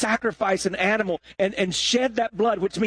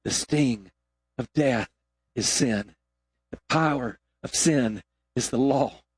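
scripture reading; 4 semitones lower than usual. Acted.

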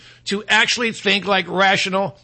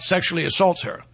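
ordinary delivery

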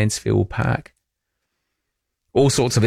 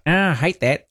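higher-pitched imitation of a grumbling listener; mock disgust; 5 semitones higher than usual. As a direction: play a listener who hates it. Acts out divided listeners' reactions.

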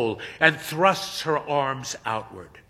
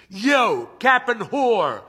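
mocking viewer; 9 semitones higher, 7 dB louder, much slower than usual. A narrator's reading. A viewer mocks in chat.

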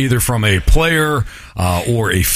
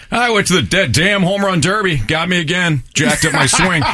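complaining ballplayer, slightly higher; whiny insecure player; 7 semitones higher than usual. Retold or imitated. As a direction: whine like an insecure athlete. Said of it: "it's what an insecure player would say".

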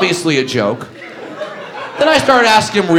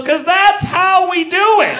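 cocky guy; 13 semitones higher, slower than usual. Retold or imitated. Wheelchair guy answering the comic, high slow cocky shout. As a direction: throw it back like a proud brag. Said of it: "he looks up at me and goes".

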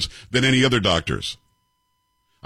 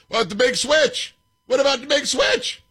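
squeaky high mock voice of a Democrat asking; mocking opponent voice; 13 semitones higher than usual.

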